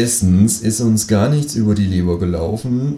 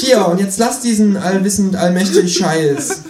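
high, loud creature voice, stretched at the end; Känguru, exasperated; 10 semitones higher, 5 dB louder than usual. A narrator's reading. Kangaroo orders writer to stop narrating, high exasperated voice. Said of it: "rief das Känguru".

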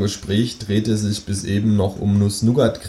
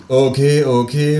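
laut gerufen, steigend; 5 semitones higher, 5 dB louder, slower than usual. Acted. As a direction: genervt dem Känguru zurufen. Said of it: "rufe ich". (laut gerufen)

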